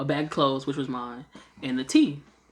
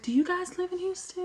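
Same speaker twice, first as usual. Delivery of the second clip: much higher, sweet put-on lady voice; seatmate lady; 3 dB quieter than usual. Seatmate asking about Houston, high mock lady voice. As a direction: chirp the question up high like a friendly stranger. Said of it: "She was like".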